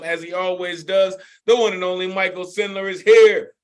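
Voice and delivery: hyped open-mic host bringing on the next act; emcee introduction. Real, not acted. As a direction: build up to the performer's name.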